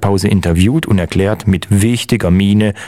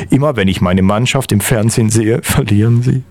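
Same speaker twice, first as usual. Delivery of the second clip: usual speaking voice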